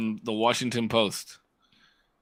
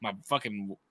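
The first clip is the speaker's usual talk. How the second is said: usual speaking voice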